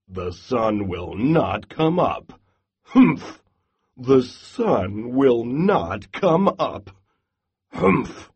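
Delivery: grump it out, then huff; grumbling Bear. Acted. Bear grumbles all night.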